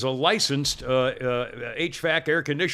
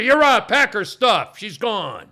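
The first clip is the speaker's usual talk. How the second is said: sudden hammed-up yell, louder and much higher; mock outburst; 6 semitones higher, 7 dB louder, slower than usual. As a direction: throw her out in a staged rage. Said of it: "that could have been overreaction".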